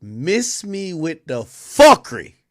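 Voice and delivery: loud, slow mock diva warning; loud slow quote. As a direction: boom it out slowly like a diva. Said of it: "She says".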